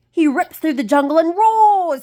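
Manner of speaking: high, loud monkey voice; chimp guide. A narrator's reading. Chimpy describing the tiger ride, high and loud. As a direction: make it sound wild and fun. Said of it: "said Chimpy".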